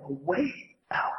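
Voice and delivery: hushed whisper of the promise; soft whispered aside. Acted. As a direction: breathe it out as a secret.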